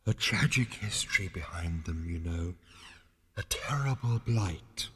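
hushed ethereal mourning; deep, quiet voice. A narrator's reading. Disembodied voice lamenting to Zaphod, deep and quiet. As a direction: intone it softly from out of nowhere. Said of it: "The voice was deep and quiet".

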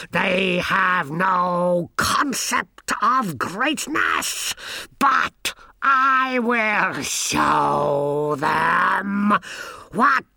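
very slow, high, drawn-out megalomania; raving mad wizard. A narrator's reading. Wizard vows to show them his greatness, raving. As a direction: drag every word out, building to a mad shriek. Bustle descends into madness.

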